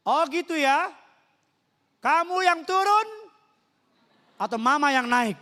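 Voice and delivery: loud, high-pitched mock scolding; threatening mother. Mother threatening to come upstairs, loud acted scolding. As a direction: warn like a fed-up mother.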